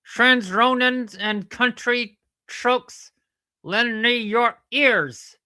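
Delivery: loud, high, slow declamation; mock oratory. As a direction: declaim grandly to a crowd, drawing it out. Puppet mock-declaims a famous speech.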